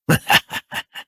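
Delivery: a breathy little laugh. Acted. Keeps laughing, amused.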